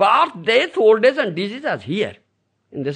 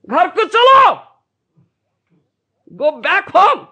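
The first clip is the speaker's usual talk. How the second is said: emphatic command; 12 semitones higher, 6 dB louder than usual. Retold or imitated. Guru's command, Hindi then English.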